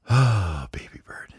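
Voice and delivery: unvoiced fond whisper. Daddy bird sighing over his eager son, whispered. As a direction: breathe it out with tired fondness.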